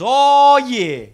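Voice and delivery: high, cocky street con man; high-pitched con man sizing up a mark; mixtape salesman. Acted. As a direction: read them like a hustling salesman. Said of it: "He moved on to the next guy and he goes".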